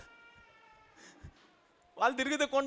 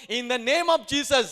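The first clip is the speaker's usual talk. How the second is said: slow, emphatic and loud claim; loud declaration; 5 dB louder, much slower than usual. Shown as a demonstration. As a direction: proclaim it slowly with force. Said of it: "ఏం చేయాలి అప్పుడు?". (నెమ్మదిగా, గట్టిగా ప్రకటన)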